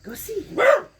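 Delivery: high, loud, squealing voice for the dog; excited dog talk. Real, not acted. Excitedly talking to the dog Sally.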